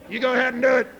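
loud, high royal command; king urging his wife on, loud; king's voice. Acted. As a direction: give the order with a flourish. King orders the queen.

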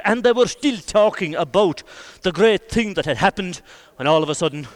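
plain reading voice

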